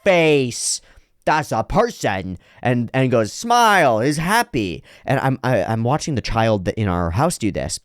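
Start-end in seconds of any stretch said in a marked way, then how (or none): 0.0-2.4 put-on slow child-like labelling, first word high; name things like a small child; imitated kid. Kid pointing out what he recognises, imitated child voice. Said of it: "the kid goes". 3.3-4.8 high, slow, delighted child imitation; imitated kid. Kid naming an expression he sees, imitated child voice. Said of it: "the kid goes".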